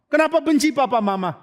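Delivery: high, insistent asking; incredulous question. Retold or imitated. Friend asking why he hates his parents, incredulous question.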